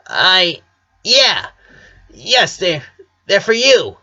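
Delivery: high, halting and flustered; a flustered tomboy stumbling over her words; ashamed. A narrator's reading. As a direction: stammer it out, embarrassed. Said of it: "said Lynn, ashamed".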